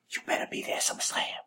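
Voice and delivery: whispered threat; menacing whisper. Acted. Closing the promo with a whispered threat. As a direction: breathe the threat out.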